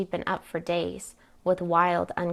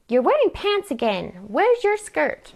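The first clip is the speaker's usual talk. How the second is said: high, louder, disapproving demand; indignant scolding; 9 semitones higher, 5 dB louder than usual. Acted. Aunt scolds niece for wearing pants, indignant. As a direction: demand an answer, pitch leaping on the question.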